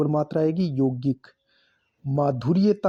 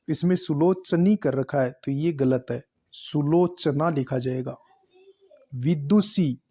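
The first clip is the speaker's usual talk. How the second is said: ordinary delivery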